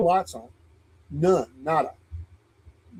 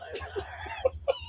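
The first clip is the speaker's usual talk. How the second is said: high laugh; 14 dB quieter than usual. Real, not acted. Laughs after the impression.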